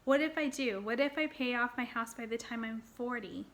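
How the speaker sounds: ordinary delivery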